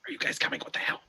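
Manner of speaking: urgent hushed whisper. Pretend. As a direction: whisper back to the group. Sneaking up in the woods.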